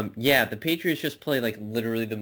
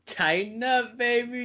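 hyped call-out; 12 semitones higher than usual. Pretend.

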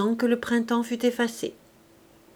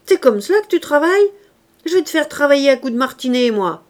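loud, high and fast threat; a mother shouting threats at the servant girl; furious yelling; 6 semitones higher, 8 dB louder, faster than usual. A narrator's reading. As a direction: rage at the child, fast and loud. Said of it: "cria-t-elle".

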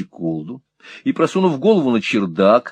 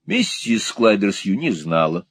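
plain reading voice

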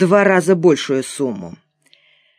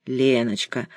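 soft, lower, tender address; affectionate; 5 semitones lower, 6 dB quieter, slower than usual. A narrator's reading. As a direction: coax her gently and quietly. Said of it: "ласково сказал я". (ласково, негромко)

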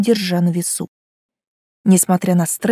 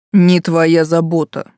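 curt; 3 semitones lower, 3 dB louder, slower than usual. Acted. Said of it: "бросил суккуб".

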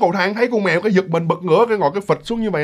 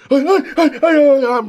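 high, loud startled "hey" cries; acted panicked yelling; 10 semitones higher, 4 dB louder than usual. Girl shielding herself from the leaping cat, panicked high yelling. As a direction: yelp repeatedly as if fending off a pounce. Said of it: "mới la la lên như vậy nè".

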